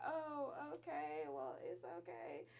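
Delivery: slow, hushed mock comforting; awkward soothing. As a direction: imitate a mom uneasily calming her crying child. Mom awkwardly soothing crying daughter.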